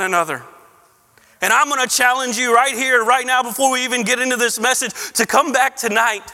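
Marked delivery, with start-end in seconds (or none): 1.4-6.3 urgent preaching. Acted. Urgent pastoral challenge.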